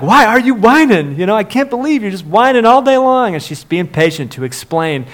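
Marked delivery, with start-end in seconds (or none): 0.0-3.3 high, loud exasperated scolding; exasperated mom. Retold or imitated. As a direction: voice the worn-out mom, pitched up. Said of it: "she's like".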